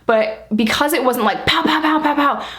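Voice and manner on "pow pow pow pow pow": rapid-fire pows, pitched up; imitated pounding knock. Storyteller imitating a knock at the door, punchy and repetitive. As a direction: imitate a hard, insistent knock in words.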